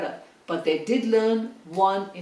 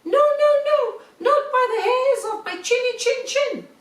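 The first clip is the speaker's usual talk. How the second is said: very high, louder piggy voice refusing; squeaky little pig; 14 semitones higher, 4 dB louder than usual. A narrator's reading. Second little pig refusing the wolf through the door, squeaky.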